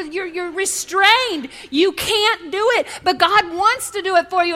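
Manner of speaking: normal talking voice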